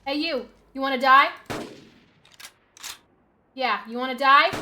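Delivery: loud, rising threat; loud taunt. Real, not acted. Gamer challenging a dinosaur, loud taunt. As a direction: bellow the challenge.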